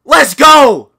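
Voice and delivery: ordinary talk, nothing marked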